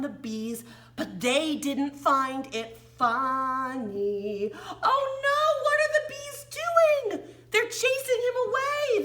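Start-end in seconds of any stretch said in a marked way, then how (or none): 4.8-9.0 much higher, louder oh no; alarmed excitement. Acted. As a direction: gasp at the chasing bees. Story peril with bees.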